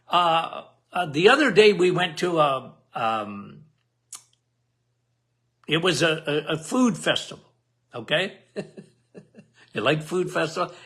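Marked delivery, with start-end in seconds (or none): none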